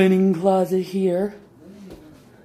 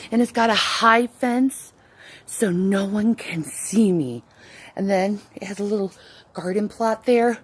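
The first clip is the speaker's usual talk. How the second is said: ordinary delivery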